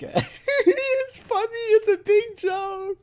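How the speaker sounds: high, slow, laughing mock. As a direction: wheeze through laughter. Imitating laughing man.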